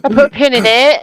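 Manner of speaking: put-on voice, rising at the end; Ben. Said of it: "you say I can't emulate Ben".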